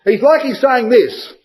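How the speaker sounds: usual speaking voice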